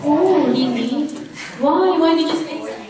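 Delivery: mock surprised. Tita reacts to child's answer.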